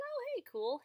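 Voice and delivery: high, bright oh hey cool; cheery greeting. Retold or imitated. Greeting a moth at night, cheerfully high. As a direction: chirp it up like greeting a friend.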